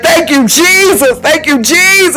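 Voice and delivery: high drawn-out worship cry; high slow praise. Shown as a demonstration. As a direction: lift it up high and stretch it. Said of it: "Other time I'm like".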